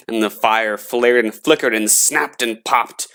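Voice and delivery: usual speaking voice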